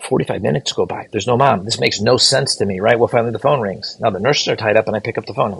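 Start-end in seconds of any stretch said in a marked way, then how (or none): none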